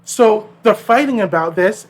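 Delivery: ordinary talk, nothing marked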